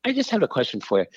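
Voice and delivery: a hint of a teasing old Jesuit; gentle priest voice.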